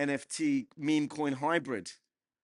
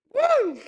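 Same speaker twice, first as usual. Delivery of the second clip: very high, loud woo; rowdy high-pitched whoop; whooping cheer; 10 dB louder than usual. Real, not acted. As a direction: let out a piercing party whoop. Cheering after the Trump shout.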